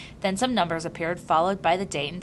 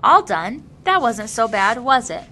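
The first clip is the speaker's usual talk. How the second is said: loud, bright and higher, all cheer; cheerful; 4 semitones higher, 9 dB louder than usual. A narrator's reading. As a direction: chirp it to the baby after the shot. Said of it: "say cheerfully".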